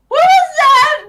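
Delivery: high, loud startled cry; startled, high-pitched demand; startled yell. Acted. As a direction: yell it out in alarm. Startled character cries out in scene.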